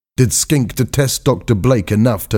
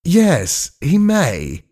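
high-pitched mock agreement; sarcastic; 10 semitones higher, much slower than usual. A narrator's reading. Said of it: "said Skink sarcastically".

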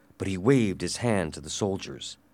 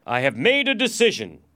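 loud, raised, authoritative announcement; commanding declaration; 10 semitones higher, 6 dB louder than usual. Acted. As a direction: proclaim it to the king. Prisoner addresses king with authority.

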